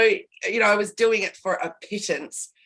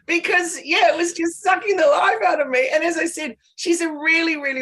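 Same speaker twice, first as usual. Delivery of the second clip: laughing; 7 semitones higher, 3 dB louder than usual. Real, not acted. Laughing about draining client.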